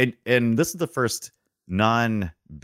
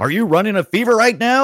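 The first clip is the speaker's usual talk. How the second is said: mock shocked; 11 semitones higher, 5 dB louder than usual. Mock alarm at a hot take.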